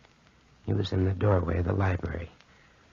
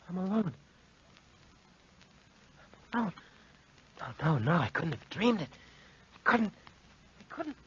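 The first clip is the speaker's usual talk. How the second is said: soft, high, breaking disbelief; fading frightened denial; shaken denial; 9 semitones higher, 5 dB quieter than usual. Acted. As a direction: whisper to convince yourself. Wounded Bill in shocked denial.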